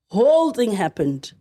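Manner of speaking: usual speaking voice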